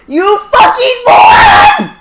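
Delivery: high, drawn-out mock yell; exasperated shout. Lelouch to departing Suzaku, imitated furious shout. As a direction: yell the insult at full stretch.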